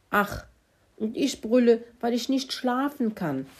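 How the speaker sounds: mild, high-pitched whine; understated wail of self-pity; wailing complaint. Acted. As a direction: moan it like a sleepless pig. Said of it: "jammerte Kalle".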